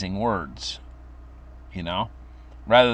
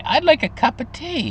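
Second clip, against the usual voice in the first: put-on sweet old grandma; 3 dB louder than usual. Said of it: "And she'd say".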